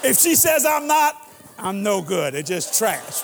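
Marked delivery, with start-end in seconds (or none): 0.0-1.2 loud and high, driving the point home; shouted punchline. Real, not acted. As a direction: shout it out to the nurse. Emphatic punchline to nurse.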